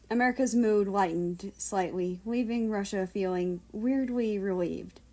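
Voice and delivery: plain reading voice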